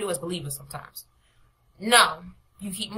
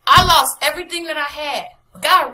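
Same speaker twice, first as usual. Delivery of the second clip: louder, proclaiming testimony; emphatic testimony; 7 semitones higher, 9 dB louder than usual. Retold or imitated. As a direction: declare it with full force.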